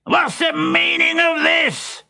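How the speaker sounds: high, slow outraged demand; thundering. A narrator's reading. Pantalone squashed in the street, thundering in outrage. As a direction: boom out the question. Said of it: "he thundered".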